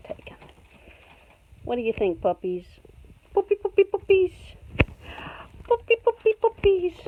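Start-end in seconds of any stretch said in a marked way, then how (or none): 3.3-6.9 high, loud, bouncy sing-song call; pitched-up pet-calling voice; high puppy call. Real, not acted. Calls to puppies in pet voice.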